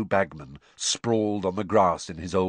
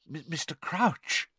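high, stammering and awed; breathless; 8 semitones higher, 4 dB quieter than usual. A narrator's reading. Percy addressing his idol, breathless. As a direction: stumble timidly onto the name. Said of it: "said Percy breathlessly".

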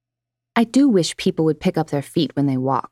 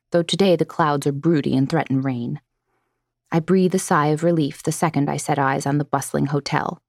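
ordinary delivery

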